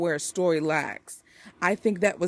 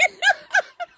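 a sudden high-pitched burst of laughter; high squealing laugh; 4 dB louder than usual. Real, not acted. Reviewer cracking up over her own three-star rating, high squealing laugh.

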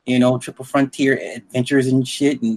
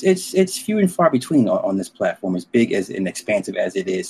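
ordinary talk, nothing marked

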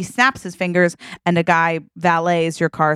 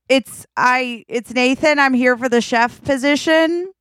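high, hesitant self-introduction; tentative announcement; 6 semitones higher than usual. Acted. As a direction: announce yourself high, rising to a question. Nervous applicant announcing himself at gate.

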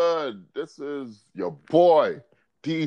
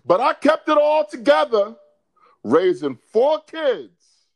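dramatic quote reading; 9 semitones higher, 4 dB louder than usual. Acted. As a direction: proclaim her statement with hammed-up drama. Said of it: "I'm gonna read you".

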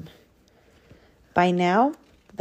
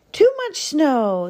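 exclaiming rabbit; 8 semitones higher, 3 dB louder than usual. Acted. Rabbit complains while rushing home.

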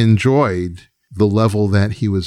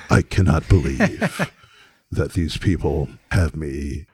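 Mooney-style lofty disbelief, pitched down; condescending; 5 semitones lower than usual. Acted. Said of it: "he was condescending about everything".

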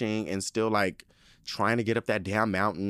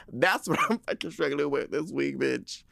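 exasperated emphasis; 5 semitones higher than usual. Real, not acted. Repeats it emphatically after laughing.

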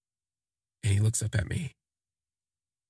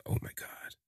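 breathless whisper, much softer; whispered gasp; 10 dB quieter than usual. Retold or imitated. Recalling his own overwhelmed reaction, a soft whisper.

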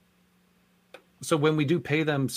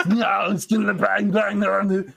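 messed-up, high and louder action-star impression; Arnold; 8 semitones higher, 4 dB louder than usual. Shown as a demonstration. Cast member shows how the asked-for voice goes, messed-up Arnold. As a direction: garble the catchphrase in a raised put-on voice. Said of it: "some weird, like, messed up version of all that".

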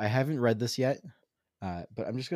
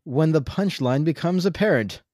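higher, louder punchline; punchline delivery; 6 semitones higher, 6 dB louder than usual. Acted. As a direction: land the punchline.

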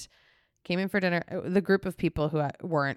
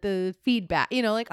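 ordinary talk

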